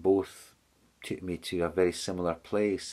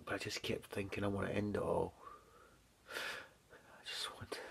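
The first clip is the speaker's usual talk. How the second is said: real emotion, much softer, fading into a whisper; near tears; 13 dB quieter than usual. Real, not acted. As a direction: let the words almost disappear as the grief takes over. Recalling suicidal thoughts, near tears.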